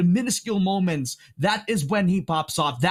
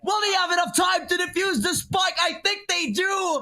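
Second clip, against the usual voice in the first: screaming hype call; 13 semitones higher than usual. Real, not acted. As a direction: scream the clutch as it happens. Clutch defuse, shouting play-by-play.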